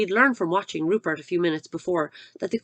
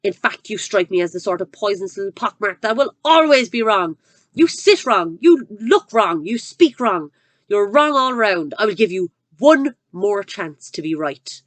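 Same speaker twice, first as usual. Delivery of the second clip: louder, punching every wrong; barked; 8 dB louder than usual. Acted. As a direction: hammer the boy with contempt. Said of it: "the Trunchbull barked".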